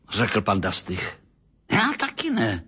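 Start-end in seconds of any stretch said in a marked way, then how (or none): 1.7-2.6 sharply raised pitch, irritated outburst; angry cry. A narrator's reading. Doctor answering that he doesn't know either, crying out angrily. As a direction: snap back in a high, cross voice. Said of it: "křikl zlostně doktor Mejzlík".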